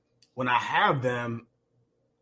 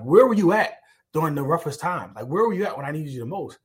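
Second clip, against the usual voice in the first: raised, louder, fast retelling of an angry cry; angry demand; 5 semitones higher, 6 dB louder than usual. Retold or imitated. The man in the poem demanding answers of the Lord, angry and louder. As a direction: push the question at the Lord, higher and louder. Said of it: "So he asked the Lord angrily".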